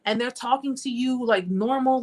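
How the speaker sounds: normal talking voice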